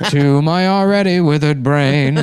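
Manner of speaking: put-on slow, high, laughing old man rant; drawn-out crazed old man.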